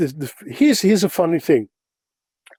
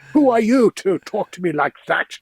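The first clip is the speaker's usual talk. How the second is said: higher-pitched mock haughty challenge; arrogant; 6 semitones higher than usual. Posh-sounding newcomer as bewildered Americans heard him, mock arrogant. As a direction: act a little like a posh, arrogant snob. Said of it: "like an arrogant prick".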